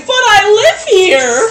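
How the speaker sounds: put-on high, slow little old lady voice; high-pitched and slow, like a fretful grandma; Granny's voice. Granny's first words, voiced quote.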